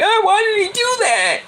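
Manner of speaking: high, mocking whine of a fake fan; mock the panicky fans; whiny fake fan. Vlogger mocking fake Met fans, high whine. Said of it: "All these fake Met fans".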